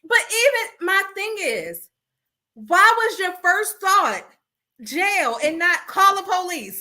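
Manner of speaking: high, slow disbelief; exasperated. Real, not acted. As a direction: ask it slowly, in disbelief.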